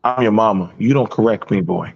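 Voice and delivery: low-pitched parental rebuke; imitated stern mother. Mother rebuking her son, imitated stern tone. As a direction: put him in his place, voice dropped.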